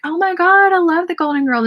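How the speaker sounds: high, gushing exclamation; gushing fan. Retold or imitated. Telling her husband's aunt she loves the show, gushing. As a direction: gush like a superfan. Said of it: "And I was like".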